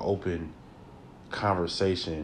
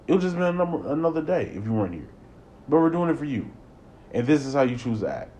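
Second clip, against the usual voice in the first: exasperated rant; 6 semitones higher, 4 dB louder than usual. Pretend. Ranting at a tantruming child.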